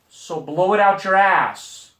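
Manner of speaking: slow, loud, defiant kiss-off; slow defiant line. Retold or imitated. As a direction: spell it out slowly to his face. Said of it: "I said".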